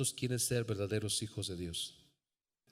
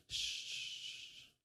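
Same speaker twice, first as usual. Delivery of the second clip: very soft, long shh; soothing shush; 12 dB quieter than usual. Acted. Parent holding a crying baby, soothing shush. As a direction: hush a baby gently under your breath.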